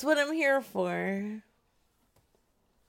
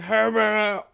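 imitating a loud crazy voice; a loud, odd character voice; Mister Biggs impression; 6 dB louder than usual. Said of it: "the best Mister Biggs impersonation ever".

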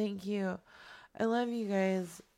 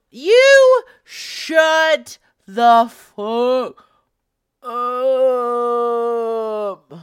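huge drawn-out yell, far louder and slow; mock furious yell; 2 semitones higher, 16 dB louder, much slower than usual. Yelling at Cynthia in chat, drawn-out mock furious yell. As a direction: bellow it at her in playful outrage.

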